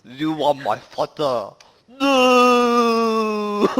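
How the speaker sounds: put-on slow, heavy Chinese accent with a high, loud wail on No; thick Chinese accent. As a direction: mimic a friend's thick Chinese accent, dragging the words. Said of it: "reciting that line in a very thick Chinese accent".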